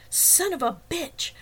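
high, drawn-out angry shout. A narrator's reading. Man bursting into the apartment, shouting in rage. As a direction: burst in yelling, stretching the curse.

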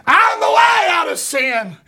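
loud, high revival preaching; fiery preaching. Real, not acted. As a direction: preach it out to the congregation. Preacher drives home main point.

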